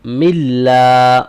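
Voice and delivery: ملا stretched long in recitation; tajweed syllable drill; drawn-out syllable. Shown as a demonstration. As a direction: hold ملا out slowly. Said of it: "اور اس کے بعد مل".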